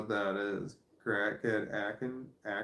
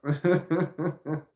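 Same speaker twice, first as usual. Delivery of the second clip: laugh; 6 semitones higher, 6 dB louder than usual. Real, not acted. Laughing mid-story.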